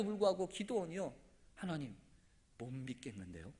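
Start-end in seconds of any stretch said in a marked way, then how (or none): 1.5-3.5 low, very soft doubting voice; timid doubt. Acted. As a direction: voice the doubt almost under your breath. Gideon timidly doubting God's promise. (자신 없이 작고 낮게)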